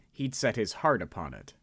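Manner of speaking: normal talking voice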